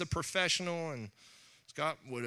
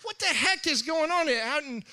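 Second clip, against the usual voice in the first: high, loud startled outburst; hammed-up surprise, pitched up; mock startled; 11 semitones higher, 6 dB louder than usual. A pastor acting out his shocked reaction, startled.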